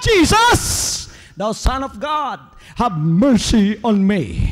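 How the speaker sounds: desperate beggar's shout. Acted. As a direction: yell it across the crowd, then plead. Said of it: "he shouted from the top of his voice".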